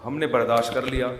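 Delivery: ordinary talk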